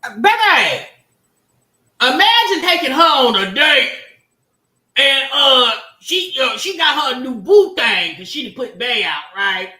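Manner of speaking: excited telling. Real, not acted. Excited storytelling setup.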